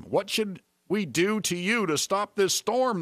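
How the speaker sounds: high, slow, urgent plea, lightly acted; desperate sailors. Sailors asking Jonah how to stop the storm, lightly acted desperation. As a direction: ask it slowly, pitched high, in mild desperation.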